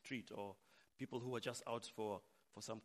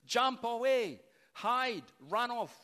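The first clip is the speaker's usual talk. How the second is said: urgent warning shout; 10 semitones higher, 11 dB louder, slower than usual. Acted. Congregation tempted to tell Jesus to flee, urgent warning shout. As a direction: cry out a high, loud warning.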